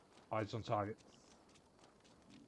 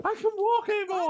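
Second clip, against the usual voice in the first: squeaky, much higher and louder whiny cry; mock wail; 11 dB louder than usual. Streamer mocks the captive woman, high hammed-up wail. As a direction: ham up a helpless prisoner's wail. Mocking the prisoner's complaint.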